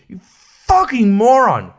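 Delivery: real frustrated outburst; angry insult. Real, not acted. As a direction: spit it out in disbelief. Ranting at [name]'s song choice.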